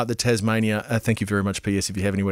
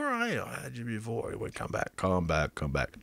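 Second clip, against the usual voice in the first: muttering while searching; 12 dB quieter than usual. Real, not acted. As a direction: mumble it to yourself, trailing away. Searching for a message, mutters to himself.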